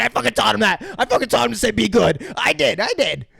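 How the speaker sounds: high, loud mock child bragging; hyped. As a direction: crow it proudly as an overexcited girl. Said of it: "And Drew's so hyped".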